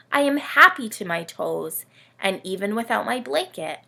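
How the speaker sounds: put-on lively read-aloud for kids, camel telling its tale; storybook reading. A narrator's reading. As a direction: tell it like a bedtime picture book. Camel narrator reads story.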